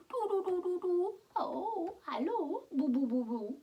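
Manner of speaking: high cooing to babies; high sing-song baby cooing; cooing baby talk. Acted. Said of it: "they cooed".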